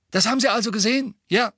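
high, fast, combative official; angriffslustig. Acted. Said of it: "sagte recht angriffslustig". (angriffslustig, hoch und schnell)

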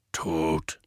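low, gruff grumbled word; grumbling Hagrid. A narrator's reading. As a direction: rumble it darkly. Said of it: "brummte Hagrid".

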